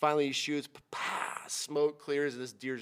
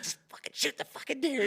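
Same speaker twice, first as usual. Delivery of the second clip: exasperated plea; 12 semitones higher than usual. Retold or imitated. Desperate hunter urging shaky client.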